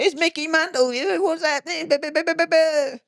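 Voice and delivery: very high, sing-song mock voice; imitating Mickey Mantle. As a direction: play him chirpy, strolling with the dog. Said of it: "him walking his dog, going".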